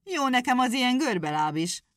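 angry hedgehog. Acted. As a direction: snap back in hurt pride. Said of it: "mondta haragosan".

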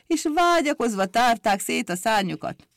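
usual speaking voice

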